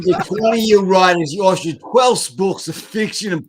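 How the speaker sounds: high loud laughing mock read. Mocking reading through laughter.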